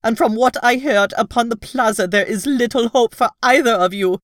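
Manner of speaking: agitated cry. Acted. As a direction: burst in with alarming news. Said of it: "she cried".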